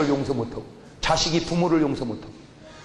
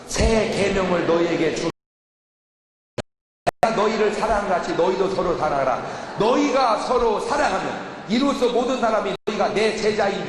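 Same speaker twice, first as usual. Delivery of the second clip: scripture reading; 4 semitones higher than usual. Acted. Preacher reads scripture aloud.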